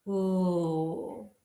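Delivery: sustained, isolated "L"; L sound. Shown as a demonstration. Teacher shows class where the tongue goes, held L sound. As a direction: hold "L" with tongue tip behind the top teeth. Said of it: "on the ridge just behind".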